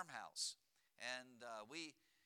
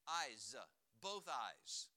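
EYES low and separate, then BOTH stressed; emphasized plural; 6 semitones lower, much slower than usual. Shown as a demonstration. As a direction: pause between, leaning on BOTH eyes. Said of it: "Let me emphasize that for you today".

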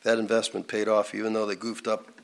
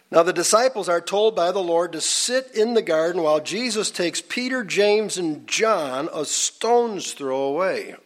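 higher, projected pulpit storytelling; preaching narration; 6 semitones higher, 3 dB louder than usual. Real, not acted. Preacher telling a congregation about the garden, raised narrating voice. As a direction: raise the pitch and set out each name distinctly.

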